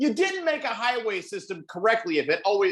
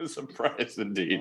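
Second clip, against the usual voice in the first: low laughing echo; 10 semitones lower, 7 dB quieter, slower than usual. Real, not acted.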